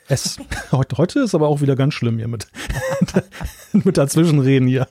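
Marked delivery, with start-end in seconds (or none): 2.5-3.5 hohes kurzes Auflachen; Lachen. Real, not acted. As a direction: kurz hell auflachen. Kollege redet dazwischen.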